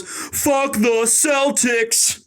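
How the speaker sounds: high, drawn-out mock sports-fan taunt; stretched-out, high fan jeer; mock fan yell. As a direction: ham up a fan taunting the camera, slowly. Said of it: "looks at the camera and goes".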